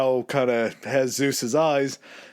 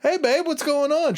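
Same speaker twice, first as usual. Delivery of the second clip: much higher, fake-innocent greeting; caught Zeus. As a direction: play dumb when caught. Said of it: "He's like".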